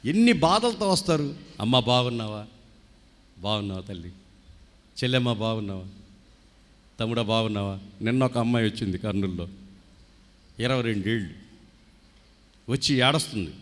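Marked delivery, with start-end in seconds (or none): 1.6-7.8 warm greeting. Retold or imitated. Good pastor greets grieving visitors.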